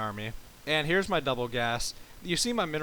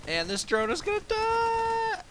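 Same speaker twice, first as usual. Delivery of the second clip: excited; 4 dB louder, slower than usual. Real, not acted. Drone about to die in fight.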